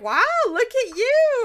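high, loud, drawn-out gush; gushing mock amazement. Friends hanging out, cheering Fluttershy on, gushing. Retold fawning praise from friends.

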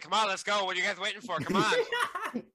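high-pitched nervous hurry; high squeaky call. Acted. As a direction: squeak it out, hurrying them along.

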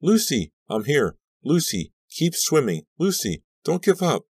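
high, urgent shouts; frantic calling, pitched high; shouted down. A narrator's reading. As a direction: shout encouragement down to her. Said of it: "I shouted down to her".

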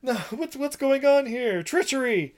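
high, startled outburst; alarmed crowd. Retold or imitated. The crowd sees the wrong bull, startled and high. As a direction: gasp it out in disbelief.

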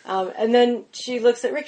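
ordinary talk, nothing marked

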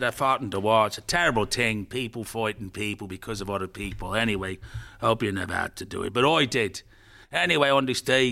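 put-on old Irish storyteller addressing schoolchildren; Irish grandfather. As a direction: spin a war story in an Irish accent. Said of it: "This Irish grandfather's been invited".

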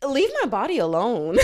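fed-up outburst; exasperated. Acted. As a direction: snap back at the meddlers. Exasperated retort to commenters.

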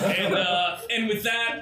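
ordinary delivery

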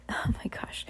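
real barely audible whisper; whispered aside. Real, not acted. Reader aside amid the captain's rambling, whispered. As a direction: whisper it to yourself, very soft.

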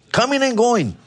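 phrase lilted up high and falling; high sing-song repeat. Pretend. Friend joking about coming and going, high sing-song. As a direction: toss the words up high playfully.

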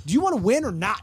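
high, loud, fired-up challenge; competitive yell. Retold or imitated. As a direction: push them to race harder. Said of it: "And she was like".

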